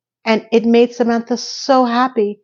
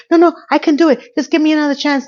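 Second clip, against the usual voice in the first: desperate begging; 8 semitones higher, faster than usual. Retold or imitated. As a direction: beg for one more chance. Laney begs Carrie outside.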